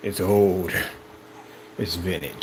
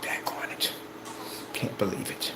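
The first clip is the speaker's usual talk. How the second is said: unvoiced, soft envious grumble; whispered mock envy; 4 semitones lower, 12 dB quieter than usual. Mimicking a jealous naysayer, whispered and soft. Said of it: "getting upset over God's blessings".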